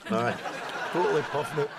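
normal talking voice

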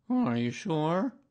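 slow, high, loud doleful question; doubtful donkey. A narrator's reading. Donkey questioning bear about missing tail, dolefully. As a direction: drag it out in a donkey character voice. Gloomy Eeyore doubts Pooh.

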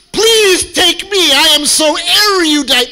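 high, loud, drawn-out pleading; mock desperate applicant. Applicant begging the college to take them, hammed-up desperate pleading. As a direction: wail it like an over-eager show-off begging. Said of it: "You can hear the noises of".

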